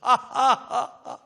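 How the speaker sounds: loud mocking guffaw; Goliath's scornful laugh; giant's booming laugh. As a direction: laugh down at the little boy. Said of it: "껄껄 웃어요".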